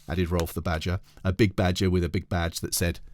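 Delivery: ordinary delivery, nothing marked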